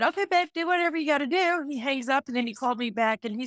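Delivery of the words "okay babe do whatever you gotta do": higher, quick retold reply; retold breezy agreement. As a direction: imitate a husband hurriedly agreeing. Husband's quick agreement on phone.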